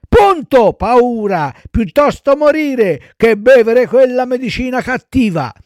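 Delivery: high, loud bratty retort; defiant child puppet. Acted. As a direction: throw it back like a stubborn kid. Pinocchio defies death over medicine. (bambino sfrontato, voce acuta)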